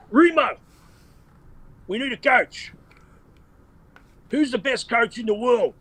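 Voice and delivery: high-pitched put-on accent impression; Con Constantine accent. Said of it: "I'll do the Con accent".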